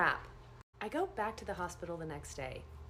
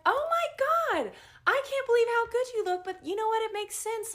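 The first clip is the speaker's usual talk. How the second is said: amazed delight; 14 semitones higher, 8 dB louder than usual. Retold or imitated. Nurse amazed at dream patient's recovery, loud high gush. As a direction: gush over how good they look.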